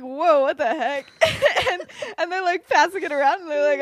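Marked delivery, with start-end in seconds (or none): 0.0-1.1 slow, loud, high mock amazement; astonished reaction. The friends catching on to the invitation, astonished. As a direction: draw it out loudly in amused shock.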